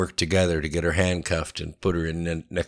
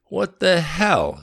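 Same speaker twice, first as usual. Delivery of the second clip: high, drawn-out, louder disbelief; startled outrage; 12 semitones higher, 5 dB louder, slower than usual. A narrator's reading. Katie turning on a stranger behind her, shocked. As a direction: exclaim it slowly, pitched way up. Woman startled by second man arriving.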